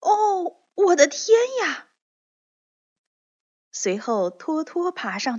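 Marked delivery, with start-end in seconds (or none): 0.0-1.9 very high, loud astonished exclamation; amazed cry. Acted. Dorothy reaching the wall top, very high astonished cry. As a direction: cry out in wonder like a girl. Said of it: "也像稻草人一样说".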